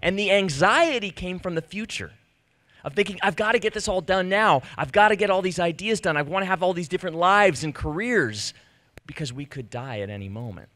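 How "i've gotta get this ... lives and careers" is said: louder, higher, pressing rush; anxious urgency. Retold or imitated. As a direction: push the words out like a to-do list on fire. Voicing his anxious future thoughts.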